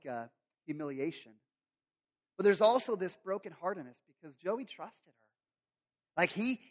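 ordinary talk, nothing marked

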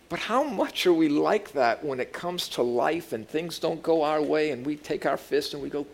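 ordinary talk

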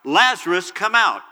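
commanding cry into the tomb; loud call. Retold or imitated. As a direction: summon him from the tomb in a loud voice. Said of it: "called out in a loud voice".

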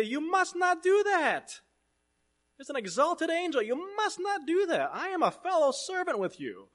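urgent angel. Acted. Preacher voicing the angel who stops John's worship, high and urgent. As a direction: cry it out high as the angel stopping him.

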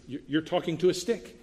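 ordinary talk, nothing marked

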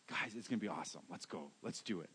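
low, very soft retold parental pep talk; hushed coaxing. Dad urging scared kids onto a coaster, hushed coaxing. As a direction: coax them under your breath.

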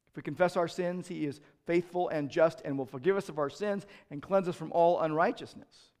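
ordinary talk, nothing marked

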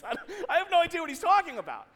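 high, loud, fast and laughing; laughing bafflement. Retold or imitated. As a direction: blurt it out through laughter. Laughing at own bluffing predicament.

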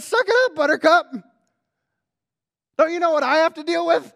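loud, high, exasperated brush-off; hammed-up scolding of a worn-out prophet; mock impatient God. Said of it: "could have come up to Elijah and said".

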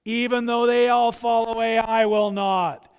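loud, boastful insistence; boastful vow. Acted. Peter boasting loudly to Jesus he won't fall. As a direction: declare it loud and proud.

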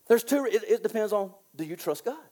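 usual speaking voice

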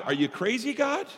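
retold incredulous prayer, rising sharply; incredulous questioning. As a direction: voice leaping high on the last word. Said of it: "there's times when I go to God".

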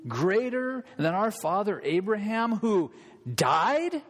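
mock him with chest puffed out; scornful Pharisee rising in pitch. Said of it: "chests all puffed out".

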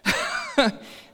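high laugh. Real, not acted. Laugh after the story punchline.

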